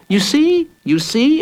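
put-on very high, excited little boy; joyous exclaiming. A narrator's reading. Jacob wins over the villain, joyous high cry. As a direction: squeal it with glee. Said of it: "exclaimed Jacob Tutu".

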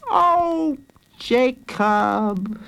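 put-on high, drawn-out girl's voice; high-voiced sisterly exasperation; exasperated sister. A narrator's reading. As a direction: sigh his name as a weary big sister. Sister turns boy down.

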